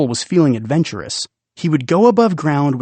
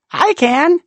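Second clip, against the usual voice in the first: put-on very high, louder little kid calling for help; child's voice; 5 dB louder than usual. Imagined child above ground asking for help with cat, piping high. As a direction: pipe up like a small child.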